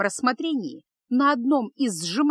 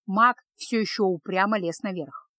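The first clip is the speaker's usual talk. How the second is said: ordinary delivery, nothing marked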